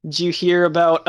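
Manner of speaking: ordinary talk, nothing marked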